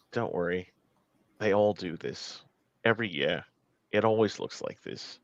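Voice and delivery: lightly voiced guide, low and soft; low, softly spoken reassurance; quiet reassurance. Rowan reassuring Penelope in the bookshop, quietly.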